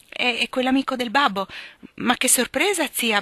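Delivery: lightly voiced flustered girl stammering. A narrator's reading. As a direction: stumble over the words, caught out. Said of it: "balbettò Meg".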